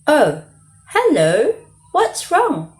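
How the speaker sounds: high, bright little rabbit. A narrator's reading. Rabbit greeting the upset girl, high and friendly. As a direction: squeak it out as a friendly bunny. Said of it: "asked the rabbit".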